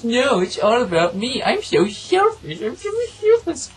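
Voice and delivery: high, whiny caricature, slurring the words; hammed-up self-pitying whine; mock a spoiled, self-absorbed character; mock selfish whiner. Mocks the selfish character's whining.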